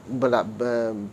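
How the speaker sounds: usual speaking voice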